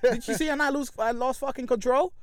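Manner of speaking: retold angry retort. Acted quarrel with building owner.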